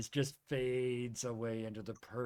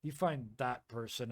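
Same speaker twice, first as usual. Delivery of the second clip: usual speaking voice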